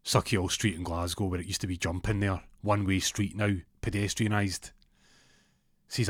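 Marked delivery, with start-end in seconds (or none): none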